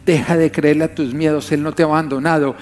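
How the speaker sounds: usual speaking voice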